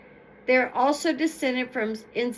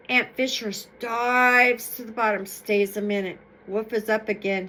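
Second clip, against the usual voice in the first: usual speaking voice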